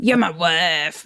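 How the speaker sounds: slow, drawn-out Texas twang as the abusive husband; slow menacing Texas accent; mock Texas accent. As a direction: growl it like a possessive Texan husband. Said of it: "That was my Texas accent".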